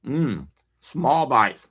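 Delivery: drawn-out, deliberate "small bites"; slow pleased murmur; slow savoring. Shown as a demonstration. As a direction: savor each word slowly. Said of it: "I'll take really small bites".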